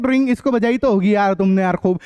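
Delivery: usual speaking voice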